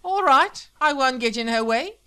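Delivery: loud, high, bitter concession; angry wife. Acted. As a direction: give up on him furiously. Armed wife quarrels with husband.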